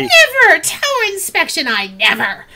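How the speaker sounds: high, loud, scandalised crone, falling at the end; indignant old lady in disbelief; old woman's outrage. Acted. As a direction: huff it as an affronted granny. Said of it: "hear an old woman's voice".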